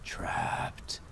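very soft, voiceless whisper; drawn-out breath of a whisper; mournful ghostly whisper. A narrator's reading. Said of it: "The voice reverberated a mournful chant".

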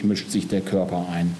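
ordinary delivery

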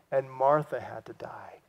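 hushed whisper, solemn. Real, not acted. Preacher reminding the church everyone dies, whispered. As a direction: let it fall to a whisper.

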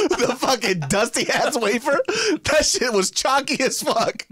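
laughing through the words, slowing down; cracking up mid-complaint about the chalky wafer. Real, not acted. Host mocking the dusty wafer while laughing.

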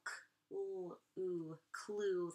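c-, l-, oo split apart, then clue blended; sounding out clue. Shown as a demonstration. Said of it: "It's gonna be C L".

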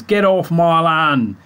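broad West Country Gloucester voice, slowed; grumpy Gloucester farmer accent. Retold or imitated. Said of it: "Proper, uh, Gloucester accent speak".

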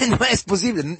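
real laugh breaking into a high line. Real, not acted. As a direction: laugh it out, amused at the idea. Predicador ríe ante lo imposible. (riendo de verdad, tono alto)

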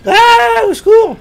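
high, loud cry for help; panicked cry for help. Real, not acted. Gamer screams for help as monsters close in. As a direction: shriek for help in real fright.

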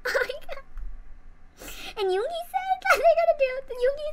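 high, loud, cracking with happy tears; real overjoyed fan on the verge of crying; tearful excitement. Real, not acted. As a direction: blurt it through tears of joy. Fan tearfully recounting [name].

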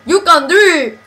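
loud, exaggerated Southern cheer; reading teacher impression. Friend proves only they do the teacher well, loud mock encouragement. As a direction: show off your best gushing teacher. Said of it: "reading teacher. She's just like".

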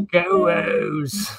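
Baltimore accent on Go O's. Shown as a demonstration. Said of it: "we got our own accent out here".

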